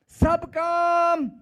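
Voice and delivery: drawn-out shouted opening for the congregation; loud slow call. Shown as a demonstration. Preacher calling out to congregation, slow loud shout. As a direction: call the words out slowly. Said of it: "फिर से बोलो".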